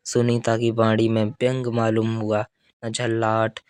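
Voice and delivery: ordinary delivery, nothing marked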